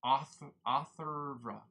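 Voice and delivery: slow, drawn-out Atharva, R joined to V; halting, stretched try at Atharva; careful sounding-out. Real, not acted. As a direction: sound out Atharva loudly, piece by piece. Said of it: "there's no A between the R and the V".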